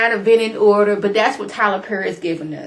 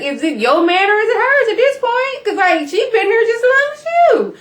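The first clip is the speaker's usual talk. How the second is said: much higher, louder disbelieving rant; incredulous outburst; 6 dB louder than usual. Real, not acted. Vlogger asks the wife whose man he is, outraged and high. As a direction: throw the question up in outrage.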